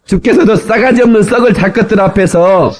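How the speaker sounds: high, rousing Jeolla dialect declamation; 전라도 사투리 낭송. Shown as a demonstration. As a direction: belt out the psalm in comic Jeolla dialect. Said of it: "전라도 버전을 제가 따왔습니다". (높은 목청의 전라도 사투리 낭송)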